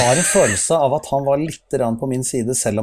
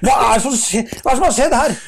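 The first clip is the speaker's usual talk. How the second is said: much higher, louder angry yelling; angry shout; 12 semitones higher, 4 dB louder than usual. Retold or imitated. Chief photographer halting the whole shoot, angry shout. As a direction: yell at the whole crew to stop the take.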